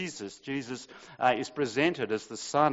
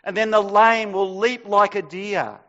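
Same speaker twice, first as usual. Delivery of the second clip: slow, paused, swelling proclamation; dramatic scripture reading; 4 semitones higher, 5 dB louder than usual. Acted. Preacher reading the prophecy of healing, dramatic. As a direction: build each phrase with pauses.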